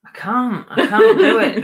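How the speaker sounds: high-pitched, laughing surrender; helpless amused protest. Real, not acted. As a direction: give up through laughter. Giving up on accent, laughing.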